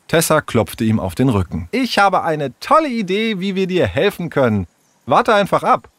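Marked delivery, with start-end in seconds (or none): 1.7-5.9 high-pitched, eager schemer; confident young friend. Acted. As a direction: promise help with excited confidence.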